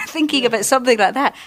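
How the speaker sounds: ordinary delivery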